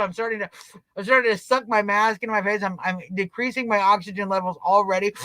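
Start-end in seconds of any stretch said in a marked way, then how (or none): none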